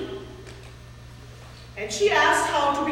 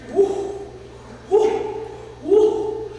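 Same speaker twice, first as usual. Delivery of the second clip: fire-breathing whoosh; 4 semitones higher, much slower than usual. Acted. Beast blowing fire.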